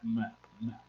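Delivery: playful echo effect; mock echo. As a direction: echo it away into the distance.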